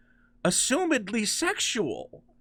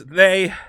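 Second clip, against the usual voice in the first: "they" held out, then left hanging; a loaded hesitation on "they"; pause after THEY; 7 dB louder than usual. Shown as a demonstration. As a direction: break off after "they" as if searching for words. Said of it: "and with that pause".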